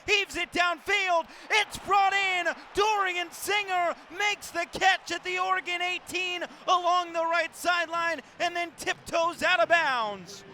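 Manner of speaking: loud, high sportscaster shout; excited big-play call. Real, not acted. Sportscaster calls the catch along the sideline, excited shout.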